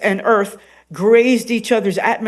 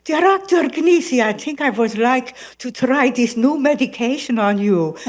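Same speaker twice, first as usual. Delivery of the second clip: higher, far-off doctor voice with a laugh; Doctor Idnas voice; 6 semitones higher than usual. Shown as a demonstration. Doctor Idnas proposing new medication to Gnisi, higher sly voice. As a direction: offer the pill like a sly doctor. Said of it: "she'll come and say".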